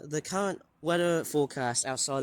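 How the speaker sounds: ordinary talk, nothing marked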